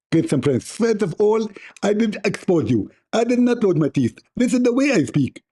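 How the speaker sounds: high, lisping mock preacher voice with missing teeth; mocking Zakir Naik. Mocking the rival preacher to his viewers in a high lisping voice. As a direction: ham up a toothless lecturer sneering at him. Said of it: "You will speak like Zakir Naik".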